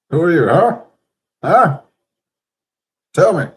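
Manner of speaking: put-on high, loud old father demanding; Who are you flung out in alarm; loud demanding Isaac. Blind Isaac demands who stands before him, loud.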